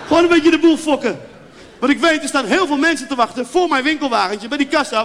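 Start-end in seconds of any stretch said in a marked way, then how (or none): 0.1-1.2 loud high gleeful rant; louder, higher, enjoying the mischief. Real, not acted. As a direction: relish the prank out loud.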